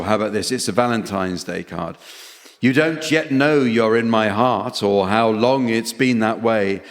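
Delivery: ordinary talk, nothing marked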